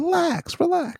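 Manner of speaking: high, soft relax relax; patronizing calm-down; mock soothing. Friend teasing him to relax, high and soft. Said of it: "I'm gonna go".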